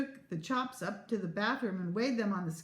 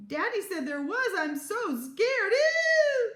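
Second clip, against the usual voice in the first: high squeal rising to a drawn-out shriek; building terrified wail; scared squeal; 12 semitones higher, 6 dB louder, slower than usual. A narrator's reading. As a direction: squeal in hammed-up fright, stretching the last words. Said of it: "continued to squeal".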